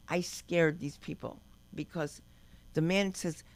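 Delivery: ordinary delivery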